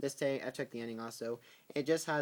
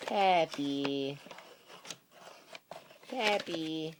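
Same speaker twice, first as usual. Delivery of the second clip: higher, louder call of the dog's name; calling the pet; 5 semitones higher, 4 dB louder, much slower than usual. Real, not acted. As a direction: call out to the dog.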